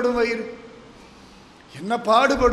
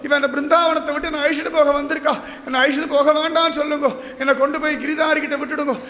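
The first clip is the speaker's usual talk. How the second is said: wailing plea; 3 semitones higher than usual. Acted. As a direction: beg desperately, hammering each repeated plea. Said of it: "கதறி எழுந்து ஓடுறா". (கதறி மன்றாடும் குரல்)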